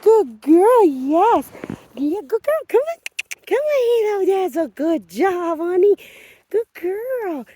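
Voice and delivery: put-on baby talk. Shown as a demonstration. Trainer demonstrating baby-talk praise for her dog. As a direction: show how to gush over the dog. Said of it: "using baby talk is okay for verbal praise".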